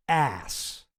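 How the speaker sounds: Ass with the A cut off abruptly. Shown as a demonstration. Streamer demonstrating a funny word to chat, abrupt A. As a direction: snap the A of Ass short and sudden. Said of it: "almost say the A, like, really abruptly".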